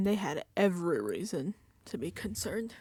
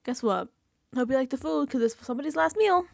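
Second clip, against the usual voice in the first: high gloating villain taunt, louder at the end; sneering host voice climbing to a loud jab; 7 semitones higher, 3 dB louder than usual. Retold or imitated. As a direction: gloat over them like a cartoon mastermind. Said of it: "he says to them".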